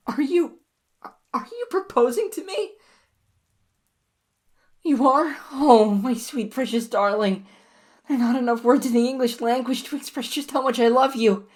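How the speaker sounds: put-on overjoyed girlfriend answering a marriage proposal; ecstatic. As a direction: squeal with delight at the ring.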